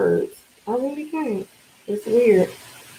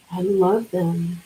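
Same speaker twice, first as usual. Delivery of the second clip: ordinary delivery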